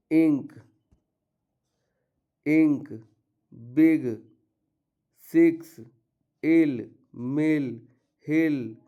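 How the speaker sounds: "इंक" "बिग" said with a short clipped i; short i demo. Shown as a demonstration. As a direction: keep the i short in each word. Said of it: "आई का उच्चारण पहला क्या हो जाएगा? ई, छोटी". (छोटी "इ" वाला उच्चारण साफ़ दिखाना)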